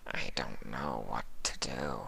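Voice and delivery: low, very soft murmur; helpless and hushed; lost changeling. Acted. Sympathy lost beside Fluttershy, low and very soft.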